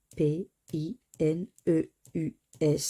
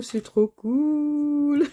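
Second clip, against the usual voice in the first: slow, higher, louder glee breaking into a laugh; delighted; 7 semitones higher, 8 dB louder, much slower than usual. Real, not acted. Winning a ticket, delighted laughing glee. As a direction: savour it, then laugh out.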